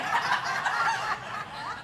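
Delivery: high delighted laugh; high giggle. Real, not acted. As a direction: giggle at the fine doctor. Laughing at cute doctor.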